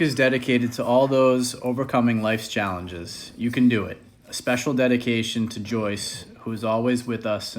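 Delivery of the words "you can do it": usual speaking voice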